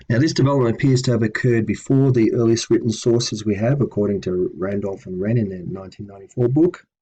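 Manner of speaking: normal talking voice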